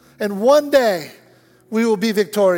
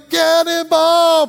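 high, loud, stretched-out shout; kid's cannonball yell; 9 semitones higher, 7 dB louder, much slower than usual. Retold or imitated. Little boy running into the pool, gleeful yell. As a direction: yell it long while leaping. Boy's jump yell acted again.

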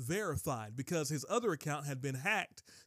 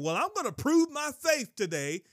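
lightly acted, high, loud declaration; imitating a self-willed boast, high and loud; mock eager Abraham; 9 semitones higher, 7 dB louder than usual. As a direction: announce it with mock resolve. Acts out Abraham boasting of faith.

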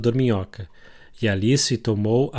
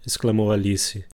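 ordinary delivery, nothing marked

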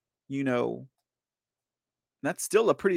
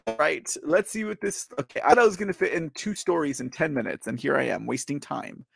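usual speaking voice

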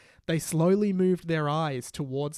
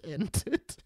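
usual speaking voice

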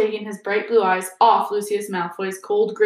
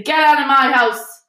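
loud, raised angry order; furious; 5 semitones higher, 7 dB louder than usual. A narrator's reading. Hagrid ordering a visitor out of his house, furious. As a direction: throw the intruder out, louder and higher. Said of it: "said Hagrid furiously".

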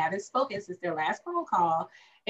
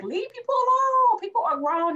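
much higher and louder, laying down the law; emphatic outburst; 5 dB louder than usual. Real, not acted. Repeating her sisters check-in advice, much higher emphatic declaration. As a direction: exclaim it like a firm sermon point.

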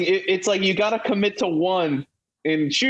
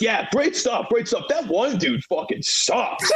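imitating the dad; 6 semitones higher, slower than usual. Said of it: "he's like".

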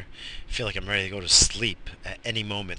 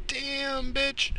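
high sassy squeal; campy high-pitched damn bitch; mock sassy exclamation. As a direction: squeal it in a much higher sassy voice.

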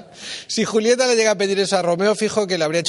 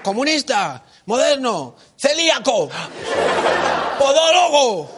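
crescendo of insults; 5 semitones higher, slower than usual. Retold or imitated. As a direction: build the insults up step by step. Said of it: "el crescendo".

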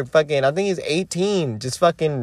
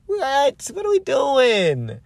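high, stunned what; incredulous outburst; 10 semitones higher, slower than usual. Retold or imitated. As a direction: shout it up high in disbelief. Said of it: "I'm like".

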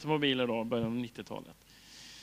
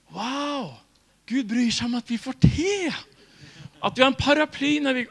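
amazed wonder; 10 semitones higher, 8 dB louder than usual. Real, not acted. Marvelling at God's care.

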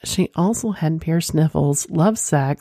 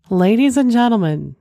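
big showman announcement, higher and louder; announcer voice; 7 semitones higher, 3 dB louder than usual. Acted. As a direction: announce it like a ringmaster. Grand announcement to the audience.

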